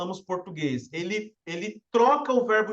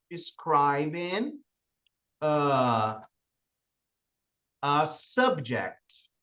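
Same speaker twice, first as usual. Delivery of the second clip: slow, careful Describing a subject; teacher dictating Describing a subject slowly; slow English term; 5 semitones lower, much slower than usual. Shown as a demonstration. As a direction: draw out Describing a subject for students. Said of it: "o que o verbo to be tá fazendo".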